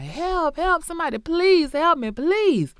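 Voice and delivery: high, loud mock cries for help; imitating screaming for help. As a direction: wail for help like the victim. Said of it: "And she just screaming".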